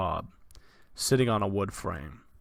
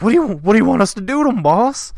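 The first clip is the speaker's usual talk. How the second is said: fast, much higher, louder babbling lackey; eager henchman; 11 semitones higher, 12 dB louder, faster than usual. Acted. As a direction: rush the question out. Henchman asks the boss.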